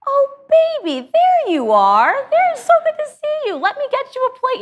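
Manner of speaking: put-on a granddad suddenly overjoyed to see the child at breakfast; doting granddad. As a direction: gush over the grandchild like a beaming granddad. Granddad greeting warmly.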